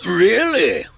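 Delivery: high, amused wizard asking back. Acted. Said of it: "said the wizard".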